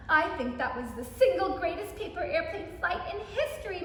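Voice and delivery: ordinary delivery, nothing marked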